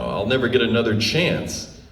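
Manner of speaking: ordinary talk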